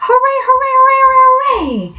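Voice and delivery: very high, loud triumphant cheers; jubilant cheering. A narrator's reading. Delighted Rat jigging in the snow, cheering. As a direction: whoop it up with joy. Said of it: "Suddenly the Rat cried".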